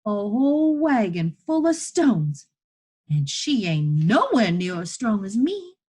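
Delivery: put-on high, loud boy telling it urgently; desperate, excited boy. Boy proving to chained woman he can free her, desperate. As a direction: argue at full volume, voice pitched well up. Elijah argues with story.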